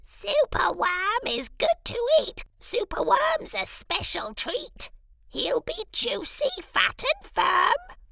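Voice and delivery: croaking crow. Acted. Crow gloating over Superworm, high croaking screech. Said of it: "dreadful croak".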